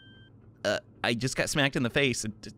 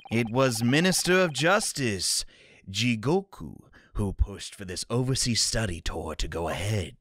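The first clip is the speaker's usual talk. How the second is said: ordinary talk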